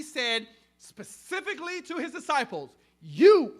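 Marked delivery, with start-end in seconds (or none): 3.0-3.5 YOU punched out very loud; emphatic YOU. Retold or imitated. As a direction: hit YOU hard, singling out the listeners. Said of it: "it is emphatic".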